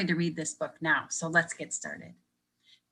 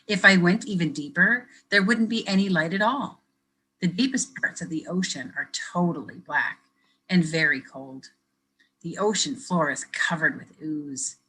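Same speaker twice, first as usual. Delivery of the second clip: lively picture-book reading; reading to children; 4 dB louder than usual. Acted. Teacher reading a picture book to kids, lively storytelling. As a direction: read it brightly for young listeners.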